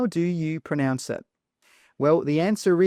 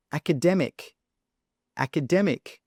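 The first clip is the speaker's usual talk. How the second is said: "academic" with the main beat on DEM; ak-uh-DEM-ik, lean on DEM in "academic"; stress on DEM; 2 semitones higher than usual. Shown as a demonstration. Teacher asks where the stress is, stressing DEM. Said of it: "it's on the dem".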